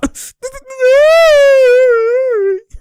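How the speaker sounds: long, high, loud howl of laughter; long shrieking laugh. Real, not acted. Losing it at a tough play, long shrieking laugh. Reacting to a highlight.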